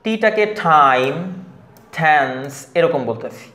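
টাইম, টেন্স with t like ঠ; slow, with a puff of air on t; aspirated t. Shown as a demonstration. Teacher slowing down to show the aspirated t. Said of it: "তার মানে টিটাকে ঠ বলতেছি".

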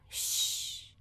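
long unvoiced shh; shushing sound. Shown as a demonstration. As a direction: hush them with a soft shh. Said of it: "pedindo silêncio".

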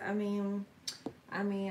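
usual speaking voice

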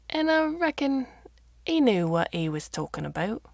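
lightly voiced old Yorkshire farmer, dropping to a whisper midway; a hint of a country farmer confiding; mild Yorkshire farmer voice with a hushed aside.

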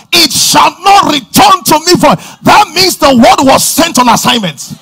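loud, climbing sermon shout; preaching at full force. Acted. Preacher declaring the word to the church, loud full-force preaching. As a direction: thunder it out from the pulpit.

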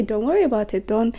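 ordinary talk

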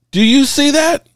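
high rising disbelieving question; emphatic question. Real, not acted. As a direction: push it up high, make them see it. Driving home the receiver stats.

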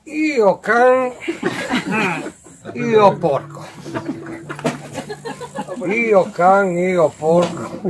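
Friulian swearing mutter. Acted. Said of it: "butta lì una bestemmia".

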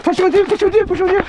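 urgent callout. Real, not acted. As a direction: call it out fast and urgent. Urgent call to teammate.

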